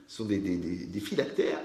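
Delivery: usual speaking voice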